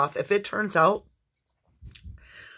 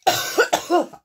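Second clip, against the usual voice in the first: high, loud hacking cough; coughing fit; 12 semitones higher, 6 dB louder than usual. Real, not acted. Reader's coughing worse than ever, harsh hacking cough. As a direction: cough as if something is stuck. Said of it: "Never like this".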